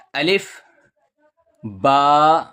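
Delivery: normal talking voice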